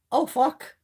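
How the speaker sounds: high-pitched retold dread; mock alarm. Woman as huge dog runs at her, mock alarm. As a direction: yelp it lightly as if about to be flattened.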